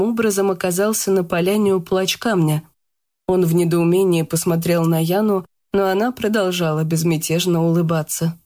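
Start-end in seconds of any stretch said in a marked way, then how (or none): none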